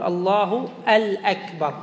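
slow "الله الأكبر" with an extra "al" before "akbar"; إضافة ال التعريف. Shown as a demonstration. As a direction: say "allahu al-akbar", article clearly sounded. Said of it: "قد يضيف ال التعريفية". ("الله الأكبر" ببطء مع إظهار ال)

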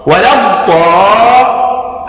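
slow chanted الضاء, pressed D, long vowel; shadda on the dad. Shown as a demonstration. Teacher shows the tenth shadda, doubled dad in الضاء. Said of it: "لا بد من تشديد الضاد".

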